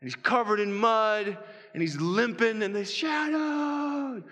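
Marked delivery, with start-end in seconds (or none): 2.6-4.2 high, long-held cry; elated, stretched-out call; joyful sighting. Acted. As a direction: draw the dog's name out high. Climax, dog appears over hill.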